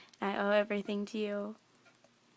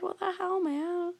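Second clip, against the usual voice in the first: put-on hurt child; 7 semitones higher than usual. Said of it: "I was like".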